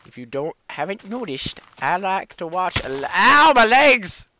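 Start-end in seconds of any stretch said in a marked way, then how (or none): 3.0-4.3 drawn-out, louder ow of fake pain; slow hammed-up cry of pain; mock pain yell. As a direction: yell it like you fell hard. Pretend injury in game.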